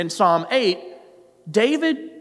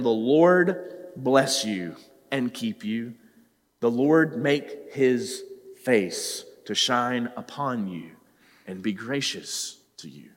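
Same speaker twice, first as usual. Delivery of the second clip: soft, slow benediction; a hushed priestly blessing; gentle blessing; 7 semitones lower, 4 dB quieter, slower than usual. Acted. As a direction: bless them tenderly.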